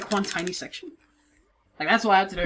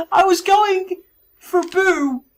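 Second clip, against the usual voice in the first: high, loud, slow wail; overwrought, tearful-sounding whine; incredulous wail; 10 semitones higher, 7 dB louder, much slower than usual. Real, not acted. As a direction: cry it out in disbelief, drawn out. Mock wailing at unexpected game luck.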